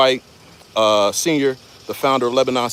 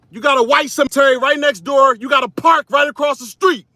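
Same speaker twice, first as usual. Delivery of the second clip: raised, high-pitched angry challenge; angry confrontation; 10 semitones higher than usual. Real, not acted. Confronting woman with dogs in cemetery.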